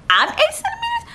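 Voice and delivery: very high-pitched, incredulous squeak; squeaked disbelief. Retold or imitated. Patient hearing how far along she is, squeaky disbelief. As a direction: shoot way up in mock astonishment.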